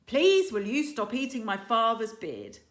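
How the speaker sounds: put-on boy straining to shout at his dragon; weak yell. A narrator's reading. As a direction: try to yell and not quite manage. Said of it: "Hiccup yelled as loudly as he could, which wasn't very loud".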